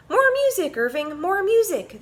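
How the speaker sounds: high, loud eager demand; demanding cheer. A narrator's reading. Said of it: "they demanded".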